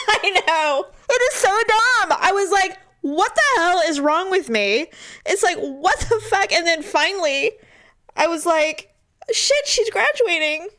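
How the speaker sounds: embarrassed, still crying while laughing at herself; laughing through tears. Real, not acted. Mother admits crying over her graduating daughter, teary laughter. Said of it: "you all got to hear me cry".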